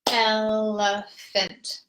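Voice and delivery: very slow, elephant in three even claps; e-le-phant, one clap each; rhythmic clapping count; clapped syllables. Shown as a demonstration. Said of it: "If you're clapping".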